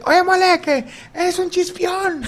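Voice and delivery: high, slow prissy taunt; high drawn-out mock insult; mock polite heckler. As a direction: drag it out sweetly like a fake-nice fan. Mimicking polite fan insult.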